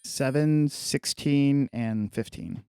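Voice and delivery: ordinary talk, nothing marked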